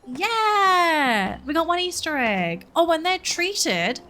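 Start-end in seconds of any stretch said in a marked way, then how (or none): 0.1-1.4 cheer. Real, not acted. Collects egg.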